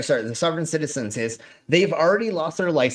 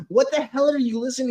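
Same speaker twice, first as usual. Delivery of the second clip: high, fast mock dad; imitating a puzzled father; 9 semitones higher, faster than usual. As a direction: rattle it off as the baffled dad. Dad reacting.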